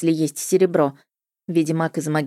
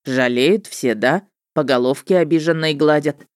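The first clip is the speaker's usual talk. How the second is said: goading witcher; 2 semitones lower than usual. A narrator's reading. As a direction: needle her, pitched down a little.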